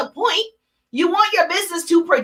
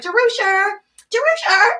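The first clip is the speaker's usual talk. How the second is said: higher, put-on calling voice; mock calling out a name, pitched up; imagined caller; 5 semitones higher than usual. Imagined visitor knocking at her door, mock high-pitched calling. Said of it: "No one's knocking at my door".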